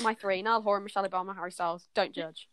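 low, deflated and a little sheepish; pathetic. Real, not acted. Friend shyly repeats her dinner guests, low and deflated. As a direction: mumble your list, embarrassed to say it. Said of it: "why did she sound so pathetic".

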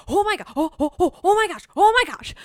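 loud, high, giddy exclamations; mock freak-out. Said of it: "was freaking out".

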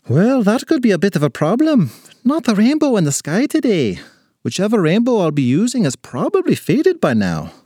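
high, lilting little man; leprechaun raising a problem. Acted. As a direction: point out the snag in a leprechaun voice.

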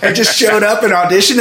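usual speaking voice

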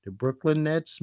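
ordinary talk

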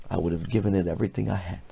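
ordinary delivery

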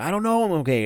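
hammed-up pained voice, pitch jumping high; mock the overselling of a fake injury; faking being hurt. MJF faking a sucker punch injury to Jericho, hammed-up pained. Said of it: "oversells this 'cause obviously he's faking".

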